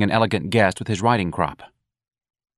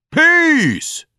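shouting; 7 dB louder than usual. Acted. Repeated cry at its peak.

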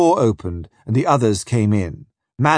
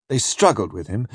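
ordinary delivery

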